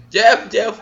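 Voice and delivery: loud, high call of a name, half-joking; mock teacher calling. Friend mocks the teacher taking attendance, calling loudly.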